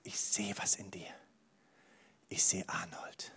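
leise gehauchtes Flüstern; flüstere es wie ein Geheimnis; geflüstert. Pretend. Gott flüstert, scherzhaft. (geflüsterte Gottesstimme)

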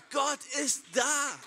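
lauter, hoher, langsamer Ruf „Gott ist da“; Predigerruf. Acted. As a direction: ruf es der ganzen Gemeinde mit voller Kraft zu. Prediger ruft es der Gemeinde zu. (lauter, kraftvoller Predigerruf)

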